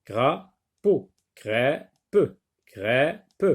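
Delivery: "crêpe" split as crê, pause, pe; two spaced beats for "crapaud" and for "crêpe"; syllable by syllable. Shown as a demonstration. Teacher tapping syllables for pupils, "crêpe" said crê... pe. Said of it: "frappe les syllabes".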